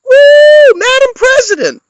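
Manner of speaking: mocking wealthy Clinton fans cheering their win; mock cheering wine moms. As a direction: whoop like a tipsy rich supporter celebrating. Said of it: "wealthy women with the two wine glasses".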